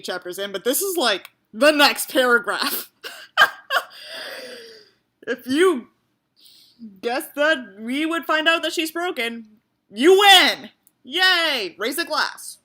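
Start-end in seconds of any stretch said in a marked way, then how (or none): 3.0-4.7 incredulous laugh. Real, not acted. Laughing at the next paragraph. 7.3-12.6 mock cheer. Mock celebrating predictable plot.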